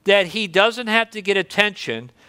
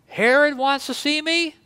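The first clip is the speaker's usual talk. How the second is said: higher incredulous question; a mock surprised question about Herod; 8 semitones higher than usual. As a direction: disbelief that Herod would ask. Jesus hears of Herod's summons.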